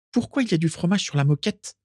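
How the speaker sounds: high, demanding question; insistent. A narrator's reading. Carla questioning Fergus about the mess, insistent. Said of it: "insista Carla".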